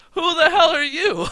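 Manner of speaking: high, loud laughing sneer; laughing taunt. Retold or imitated. Champion mocking the newcomer, high laughing sneer. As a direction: laugh it out in disbelief. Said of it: "he goes".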